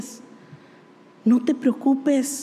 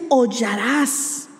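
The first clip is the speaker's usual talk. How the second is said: hollarás drawn out very slowly, each syllable weighted; slow emphasis; 3 semitones lower, much slower than usual. Shown as a demonstration. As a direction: lean into hollarás, slow and heavy. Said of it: "¿Sabe lo que es hollar?".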